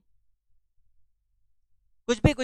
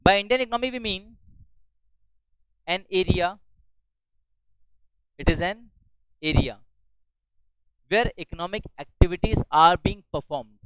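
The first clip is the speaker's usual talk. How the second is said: very slow, each word spaced for note-taking; slow dictation; much slower than usual. Shown as a demonstration. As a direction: dictate it slowly so they can write. Teacher dictating a definition. (बहुत धीरे लिखवाते हुए)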